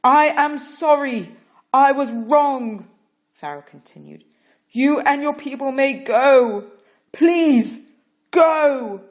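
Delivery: loud, high, slow begging; beg, near tears; desperate plea. Acted. Pharaoh begging Moses to leave, desperate loud plea.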